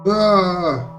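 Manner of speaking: sharp, high pained cry; a short gasp of pain as the bolt hits; a loud cry of pain, struck by an arrow; cry of agony. Acted. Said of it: "I exclaimed in agony".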